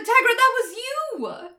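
high, loud yell of disbelief; exasperated yell. Retold or imitated. Viewer yelling at Tigra for his own mistake, loud and exasperated. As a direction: yell the blame right back at him.

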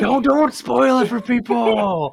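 high, slow mock scolding; drawn-out playful scold. Real, not acted. Scolding friend for spoiler.

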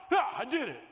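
mock triumphant cheer. Triumphant finisher quote.

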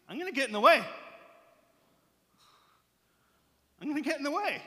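high-pitched defiant vow; mock-tough protectiveness. Man defending his boy Jesus from attackers, playful defiance. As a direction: declare it like a protective friend squaring up.

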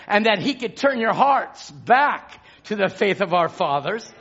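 ordinary delivery

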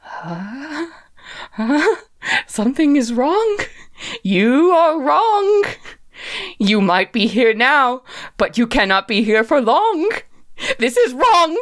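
high-pitched creature, rising in agitation; alarmed creature voice. Acted. Gordon to the witch he shies from, alarmed. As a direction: insist that something is badly wrong. Gordon retreats, protesting to her.

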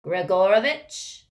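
high, loud cold call. A narrator's reading. Dark wizard at the door, high and cold. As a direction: call the name high and icy. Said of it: "said a high, cold voice".

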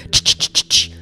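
rapid unvoiced "ch" bursts; sharp repeated stabbing sound effect; imitated stabbing noise. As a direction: mime quick knife stabs with your mouth. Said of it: "serrated hunting knife and I'm like".

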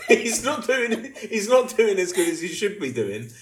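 high, loud, giggly mock-mum complaint; laughing mum impression. Friend keeps voicing the player's mum, laughing. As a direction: play the fussing mum while cracking up. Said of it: "imagine his mum said".

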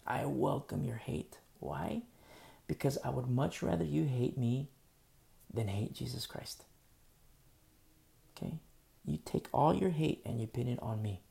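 normal talking voice

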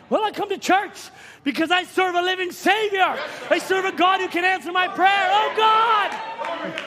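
high-pitched preaching fervor; fervent rejoicing. Acted. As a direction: build to an exultant cry. Preacher acts out fervent rejoicing worshipper.

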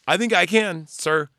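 loud, high recruit reply; imitating a recruit sounding off; eager recruit. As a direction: pipe up boldly to the sergeant. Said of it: "one of the recruits goes".

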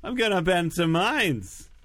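high Yiddish clown voice; Doctor Mindbender. Acted. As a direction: put on a coy, high-pitched clown. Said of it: "now a Yiddish clown and very coy".

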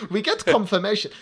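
put-on hammy German-accented announcement; bad German accent. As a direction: slip into a silly mock-German voice. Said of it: "like, my shit German accent".

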